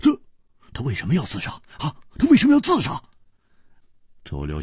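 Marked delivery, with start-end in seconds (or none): 0.0-3.0 high, stammering disbelief; flustered, rapid and incredulous; cutting in urgently. Acted. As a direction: blurt out the questions, tripping over words. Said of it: "就抢着说".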